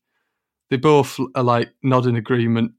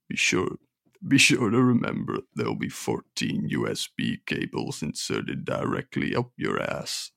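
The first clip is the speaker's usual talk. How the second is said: menacing Iggy; 11 semitones lower, 8 dB quieter than usual. Acted. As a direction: growl a quiet threat. Iggy threatening.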